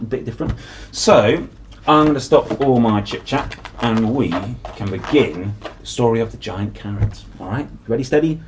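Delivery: plain reading voice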